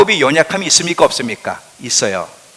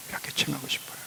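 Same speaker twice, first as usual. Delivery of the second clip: hushed whisper, much softer; 18 dB quieter, much faster than usual. Shown as a demonstration. Preacher whispering how God longs to name each person. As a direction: let it fall to an intimate whisper. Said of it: "repeats God's wish".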